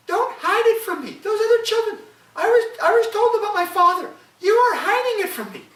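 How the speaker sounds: put-on high, loud child's protest; insist, hurt and accusing; upset child. Boy accusing his mother of hiding his father, high loud child voice.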